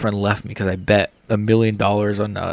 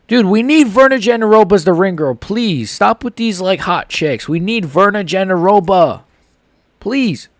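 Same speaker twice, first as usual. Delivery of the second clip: excited pleading; 7 semitones higher than usual. Real, not acted. Begging for a ring girl.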